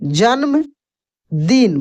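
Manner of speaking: जन्मदिन stretched very slowly, syllable by syllable; teacher lingering over जन्मदिन for dictation; drawn out slowly. Shown as a demonstration. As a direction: stretch जन्मदिन out so students catch each sound. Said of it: "teacher repeats the word for learners".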